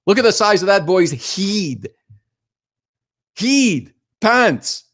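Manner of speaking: high, exclaiming Scottish dad impression; thick Scottish accent. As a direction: bark it like a comic Scottish father. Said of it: "Scottish father with a real thick accent".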